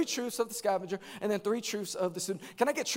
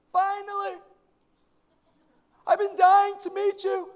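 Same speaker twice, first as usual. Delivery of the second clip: gushing fan; 11 semitones higher, 7 dB louder than usual. Retold or imitated. Young lady finally meets her pastor, high gushing mock voice. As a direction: imitate an overexcited young woman. Acting out an eager admirer.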